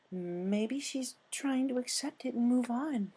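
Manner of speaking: high, slow timid pony voice; slow high timid suggestion; gentle Fluttershy. Acted.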